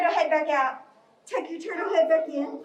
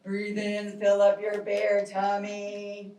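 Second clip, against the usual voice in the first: calm breathing guide; 6 semitones lower than usual. Shown as a demonstration. Leading a slow bear breathing exercise.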